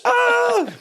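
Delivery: high, loud sudden "Oh!" of discovery; a real lightbulb moment, cried out; delighted gasp of understanding; excited realisation. Real, not acted. Sudden realisation about pitch.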